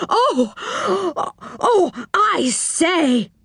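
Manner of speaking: high, drawn-out fluster; flustered little man, pitched high; excited stammering. A narrator's reading. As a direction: struggle to get the words out for excitement. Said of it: "stammering all the more".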